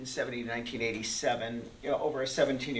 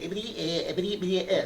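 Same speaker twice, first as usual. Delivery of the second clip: Porky Pig; 2 semitones higher, faster than usual. Retold or imitated. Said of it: "you're not gonna do Porky Pig".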